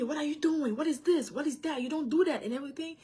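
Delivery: high, sharp scolding in English; mock scolding mother; scolding the child. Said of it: "la taper".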